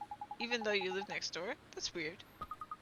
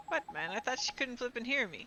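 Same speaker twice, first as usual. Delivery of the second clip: ordinary delivery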